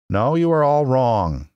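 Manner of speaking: mild, high-voiced confession; meek. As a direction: admit it meekly, drawing it out. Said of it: "said the little man meekly".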